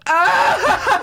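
high sudden laughing yelp; a high shriek at the TV; mock outburst. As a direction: blurt a startled cackle. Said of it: "I'd lose it".

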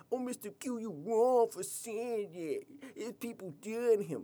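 high, slow mock-offended voice; high prissy parody of a critic. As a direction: play a scolding listener in falsetto. Imagined critic objecting.

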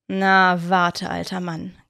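a knowing grin in the voice; grinning. A narrator's reading. Cornelius, alone at night, has found his target, grinning. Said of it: "grinste Cornelius".

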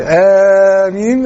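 very long drawn-out آمين; congregational amin. Acted. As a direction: hold each vowel of آمين as a mosque congregation would. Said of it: "هذه الضجة في المسجد". (تأمين ممدود جدًا كجماعة المسجد)

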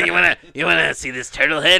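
loud, leering creepy uncle. Retold or imitated. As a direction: ham up a creepy uncle offering a turtle.

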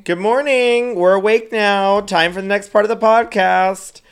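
high, sing-out morning announcement; mock wake-up call. Co-host announcing the podcast's next part, playfully chirpy. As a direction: playfully rouse the listeners.

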